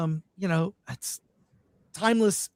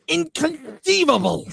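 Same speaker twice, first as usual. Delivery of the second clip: Wallace Shawn impression; 8 semitones higher, 4 dB louder than usual. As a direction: squeal it in outrage like Vizzini. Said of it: "Wallace Shawn saying".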